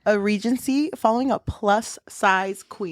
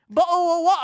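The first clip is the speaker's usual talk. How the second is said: put-on "Bottle of water" redone in a higher British accent, crisp t sounds; 8 semitones higher, 4 dB louder, faster than usual. Kid fixing his request for the teacher, British accent. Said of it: "Oh, I'm sorry".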